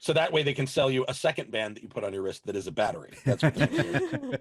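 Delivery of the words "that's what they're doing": laughing through the words; amused, breaking into a laugh. Real, not acted. Joking about a battery wristband.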